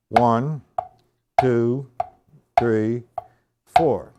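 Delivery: loud count-in, each number punched. Real, not acted. Drum teacher counts students in, loud and firm. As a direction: call the count like starting the band.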